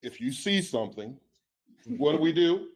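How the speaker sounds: rally the room like a coach; coach's voice. Shown as a demonstration. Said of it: "So in my coach's voice".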